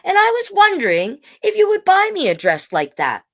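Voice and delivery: put-on child telling mother about a dream. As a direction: sound like a hopeful young child. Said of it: "said Bailey".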